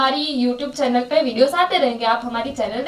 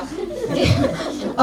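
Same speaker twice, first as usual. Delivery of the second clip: a quick high burst of laughter; short laugh; 8 semitones higher, 3 dB quieter than usual. Real, not acted. Narrator to listener, laughing at the kids' phone mix-up.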